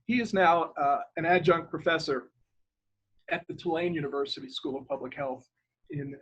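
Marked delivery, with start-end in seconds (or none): none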